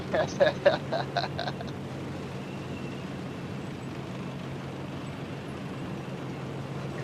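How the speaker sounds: long unvoiced-sounding chuckle trailing off; laugh. Real, not acted. Gamer amused after telling another to enjoy himself, long laugh. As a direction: laugh on and let it fade.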